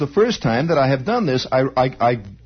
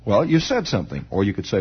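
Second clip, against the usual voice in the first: ordinary talk, nothing marked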